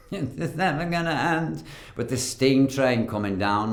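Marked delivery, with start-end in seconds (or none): 0.1-1.6 high, laughing mock moan. Actor retelling a long shoot, moaning high and laughing. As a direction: whine it out like a suffering victim tied to a track. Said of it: "all I did was moan".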